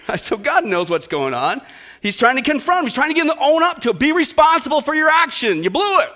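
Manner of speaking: ordinary delivery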